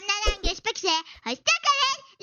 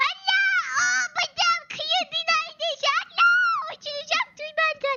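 being chased; 6 semitones higher than usual. Pretend. Running from chasers.

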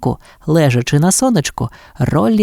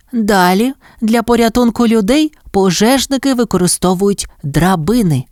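higher, lightly voiced mother teacher; mother dog Petita, instructing; 7 semitones higher than usual. A narrator's reading. Mother dog teaching pups about ladders, instructive. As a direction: announce the next task, pitched up.

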